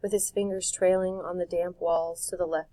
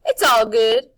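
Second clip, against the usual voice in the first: Wendell voice; 5 semitones higher, 14 dB louder than usual. A narrator's reading. Wendell voiced in read story.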